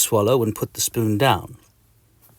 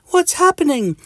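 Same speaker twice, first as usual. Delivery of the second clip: very high, alarmed squeak; Mister Itch; 5 dB louder than usual. Acted. Mister Itch sees the sky darken, high alarm.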